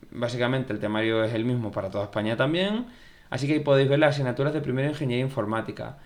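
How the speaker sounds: usual speaking voice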